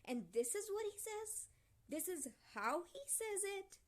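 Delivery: incredulous indignation. Real, not acted. Indignant at character's wording.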